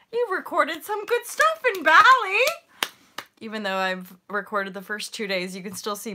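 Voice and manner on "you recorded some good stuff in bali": high, loud cheering squeal; gleeful self-praise. Acted. Congratulating herself on Bali footage, gleeful.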